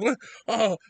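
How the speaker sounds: high, startled grandma imitation; reenacted shocked outburst; freaked-out grandma. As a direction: gasp it in mock alarm. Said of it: "my grandma freaked out".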